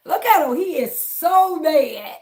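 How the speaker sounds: drawn-out mocking he is so mad; mocking taunt. Real, not acted. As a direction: crow over the angry troll. Taunting a troll on stream.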